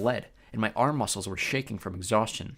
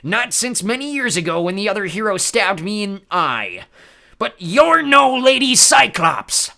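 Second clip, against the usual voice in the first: loud, high, slow roar; raging Cyclops; 10 semitones higher, 11 dB louder, slower than usual. Acted. Polyphemus discovering the trick, raging roar. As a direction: build to a furious roar.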